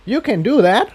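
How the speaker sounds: a gasp of wonder in the question; amazed Incendia. Acted. Incendia asks Jigsaw in high amazed disbelief.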